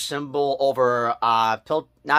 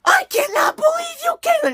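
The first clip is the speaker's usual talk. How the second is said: very high, louder yell of betrayal; mock betrayed yell; 3 dB louder than usual. Reviewer yelling at Caitlyn on screen, very high betrayed yell. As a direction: scold her like she wronged you.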